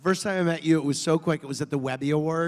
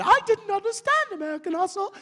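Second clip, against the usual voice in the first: much higher put-on older lady voice; Barbara Walters impression; 3 dB louder than usual. Barbara Walters complaining on The View, imitated high.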